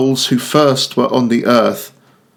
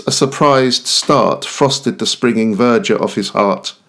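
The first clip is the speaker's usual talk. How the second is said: usual speaking voice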